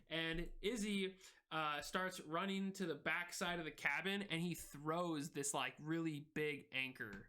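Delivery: ordinary delivery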